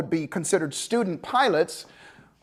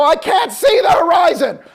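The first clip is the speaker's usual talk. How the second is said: loud, high panicked yell; student pilot shouting back at instructor, panicked; 8 semitones higher, 7 dB louder than usual. Retold or imitated.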